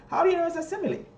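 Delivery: high teacher question; asking the class. Shown as a demonstration. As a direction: press the class for reasons. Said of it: "repeats question to class".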